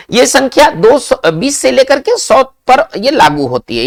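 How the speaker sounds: ordinary talk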